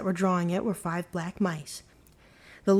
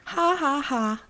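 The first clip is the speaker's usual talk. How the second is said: mocking laugh; 6 semitones higher, slower than usual. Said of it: "they laughed".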